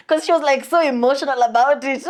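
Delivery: laughing through the words; can't hold back the giggles. Real, not acted. Laughing while telling friend about crying girl.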